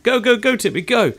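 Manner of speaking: urgent cheering. Real, not acted. Owner cheering on his turtle at feeding time, excited. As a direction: egg him on with rapid repeats. Urging the turtle toward food.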